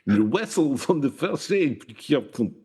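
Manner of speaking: slow, high comic Russian, breaking into laughter; mock Russian accent. Echoing co-host's captain joke, mock Russian accent.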